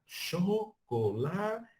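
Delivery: "chocolate" stretched slow with a high rise; calling out. Shown as a demonstration. Teacher calling across to a student. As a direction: sing out "chocolate" slowly. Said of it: "imagine você falando com a pessoa debaixo de uma ladeira".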